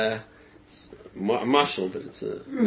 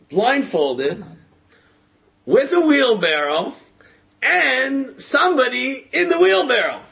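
loud, high, slow proclamation; showman's announcement; 10 semitones higher, 5 dB louder, slower than usual. Retold or imitated. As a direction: build suspense with long pauses between phrases. Stuntman announces final feat to crowd.

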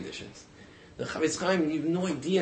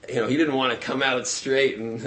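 ordinary delivery

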